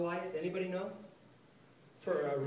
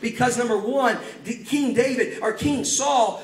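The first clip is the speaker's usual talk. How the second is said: louder, driving sermon delivery; fired-up preaching; 5 semitones higher, 7 dB louder than usual. Acted. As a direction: preach it hard to the congregation. Preaching at full force.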